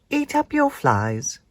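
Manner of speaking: high, slow motherly nagging; spider mum. Acted. Mum telling Spinderella to eat her flies. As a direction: tell her off gently like a busy mum.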